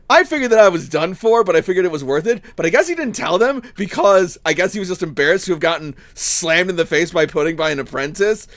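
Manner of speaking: ordinary talk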